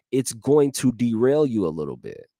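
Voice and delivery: ordinary talk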